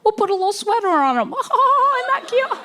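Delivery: high-pitched gushing squeal; hammed-up adorable-baby-talk gushing; cutesy cooing. Cooing over animals, mocking non-country folk.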